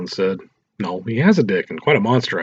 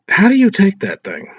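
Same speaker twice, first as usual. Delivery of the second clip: higher and louder, stunned disbelief; sick curiosity; 11 semitones higher, 7 dB louder than usual. Acted. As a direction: blurt it out, morbidly curious. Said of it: "with sick curiosity written all over his face".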